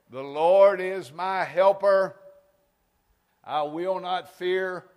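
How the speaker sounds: slow, loud, high declaration; bold proclamation. Real, not acted. Preacher proclaiming the verse boldly, slow and loud. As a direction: declare each word slowly and boldly.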